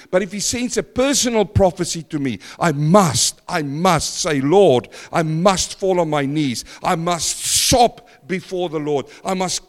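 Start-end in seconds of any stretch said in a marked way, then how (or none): none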